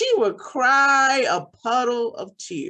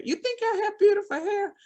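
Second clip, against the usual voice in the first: surprised mother; 10 semitones higher, faster than usual. A narrator's reading. Mother echoing child's words.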